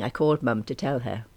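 ordinary delivery, nothing marked

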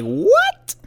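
squeaky high mock shock; a shrieked, sky-high what. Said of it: "And you're just like".

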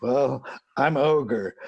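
lightly voiced burly biker, unhurried; biker impression, understated. Big biker introduces himself to the boys' dad, low and slow.

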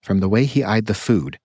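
ordinary delivery, nothing marked

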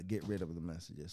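ordinary talk, nothing marked

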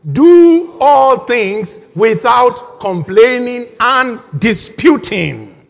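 slow, loud, drawn-out recitation; slow congregational reading. Shown as a demonstration. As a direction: read each word out slowly and loudly, leading the room. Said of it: "read it with me".

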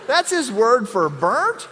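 hammed-up disbelief; incredulous question. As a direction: act out the stunned wife.

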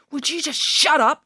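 high-pitched demand for silence; exasperated yell. A narrator's reading. Ian cuts off his squabbling team, yelling. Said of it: "yelled Ian".

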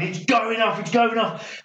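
mock pilot alarm. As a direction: sound the alarm from the cockpit. RAF pilots in a panic.

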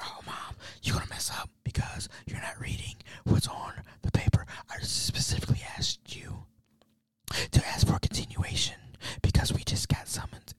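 exasperated hushed scolding; whispering. Retold or imitated. Son warns mom she'll mess up the case, whispering. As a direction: whisper your frustration under your breath. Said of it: "So I'm, I'm just whispering".

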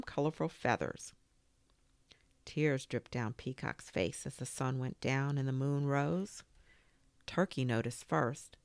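usual speaking voice

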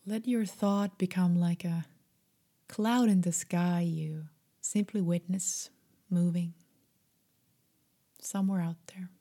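usual speaking voice

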